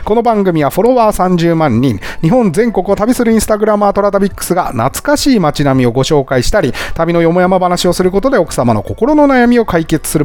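show-opening patter. Acted. Host opens the programme for listeners, announcer-style patter. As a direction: recite the show's set opening speech.

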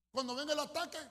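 loud, high preaching shout; preaching climax, belting it out; full-force preaching. Real, not acted. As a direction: thunder it to the congregation.

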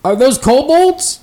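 lightly voiced, wary elf; elf rider. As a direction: elf rider questioning the party.

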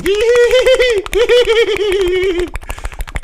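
high, loud giggling laugh acted out; picture her cackling and do it; a high mock laugh of a giggling woman; imitated laugh. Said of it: "I can picture her laughing".

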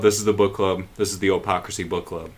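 low, level, same note throughout; low monotone. Shown as a demonstration. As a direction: drone it low on one note. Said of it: "That's perfect".

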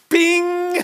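high, ringing, held "bing"; imitated bing. Preacher realizing Sandy can do the books, ringing bing. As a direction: chime it out high like a bell.

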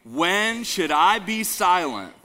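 slow, drawn-out call for the crowd to echo. Shown as a demonstration. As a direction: lead the congregation, stretching it.

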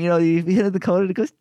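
ordinary talk

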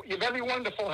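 ordinary talk

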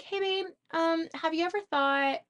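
gentle tone. Shown as a demonstration. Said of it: "you can hear the difference in my tone".

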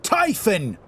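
high, loud call of a name; shout. A narrator's reading. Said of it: "he shouts".

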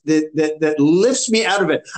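ordinary delivery

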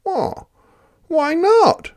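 high, loud, cheeky Dingo; a greedy dog egging the others on. Acted. Dingo cheers Platypus tipping mud in.

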